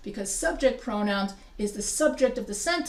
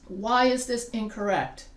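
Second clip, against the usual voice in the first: ordinary delivery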